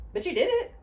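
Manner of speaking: celebrating the client's breakthrough; proud cheer. Real, not acted. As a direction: cheer them on. Praising the client's progress.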